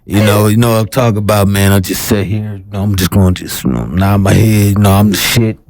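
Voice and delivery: low, rambling mumble; mock inarticulate guest. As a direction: play an interviewee with nothing to say. Mimics the unforthcoming interviewee.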